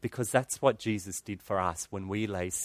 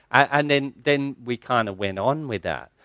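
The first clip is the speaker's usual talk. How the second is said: ordinary talk, nothing marked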